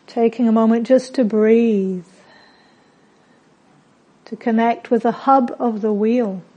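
calm meditation voice with long pauses; unhurried, settled guidance, softening at phrase ends; guided meditation. Real, not acted. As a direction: guide them gently inward, phrase by phrase.

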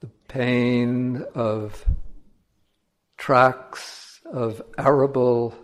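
measured meditation voice with long silences between phrases; solemn, unhurried contemplative delivery; guided meditation. Acted. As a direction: guide listeners slowly, drawing out key words.

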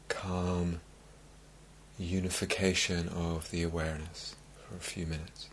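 calm meditation-teacher voice with long, spacious pauses; guided meditation. Acted. Teacher easing meditators into calm, guided meditation. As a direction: settle them gently, letting each phrase sink in.

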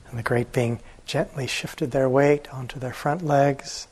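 plain reading voice

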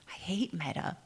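quoted complaint. Retold or imitated. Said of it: "Someone said that today in an interview".

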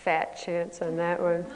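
a hint of a dry, cynical inner voice. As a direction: toss it off like a sceptical little voice. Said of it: "this little voice would say".

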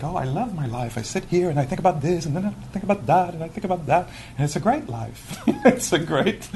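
high, fast, self-satisfied mock voice; scattered regular person. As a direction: lightly parody someone delighting in their wandering thoughts, with a laugh. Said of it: "they'll say, like".

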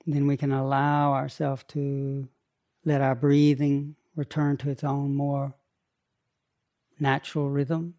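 calm, unhurried meditation guidance; guided meditation. Acted. Teacher guiding the group's breathing, in a calm meditation voice. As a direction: ease them into their breath.

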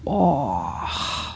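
soft sighing groan; kvetching sigh. Shown as a demonstration. Teacher telling class to let the body kvetch, soft groan. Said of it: "let your body kvetch for a moment and just go".

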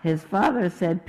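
lively storybook read with voiced characters; reading to children. Reading the Pierre story aloud to listeners, animated storybook voice. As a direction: read it like bedtime story time.

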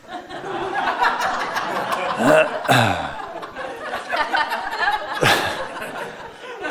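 a long, high real laugh. Real, not acted. Teacher laughing at her own punchline, real laugh.

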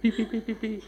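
high mock beeping like a seatbelt alarm; car warning beep impression; imitated car beeps.

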